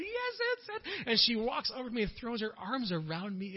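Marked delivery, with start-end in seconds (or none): 0.0-1.1 very high, drawn-out elated voice; elderly woman overjoyed at the found card; high, slow joyful cry. Retold or imitated. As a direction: savor the find. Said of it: "She's like".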